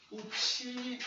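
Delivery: qi held high and level; first tone. Shown as a demonstration. Teacher showing the first tone on qi. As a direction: sing qi on a high flat pitch. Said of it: "é primeiro tom".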